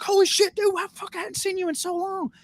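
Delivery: the friend delighted to see him again; excited friend's voice, pitched high. Retold or imitated. Friend's reply voiced in story.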